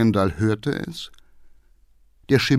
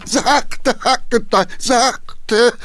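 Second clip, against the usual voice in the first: high, loud alarmed yell; shouting; 13 semitones higher, 4 dB louder, much slower than usual. Acted. Old councillor thrown in his seat, yelling. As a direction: cry out as the carriage lurches. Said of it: "schrie der Geheimrat".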